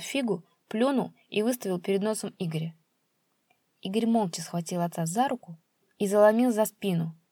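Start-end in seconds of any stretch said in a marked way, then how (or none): none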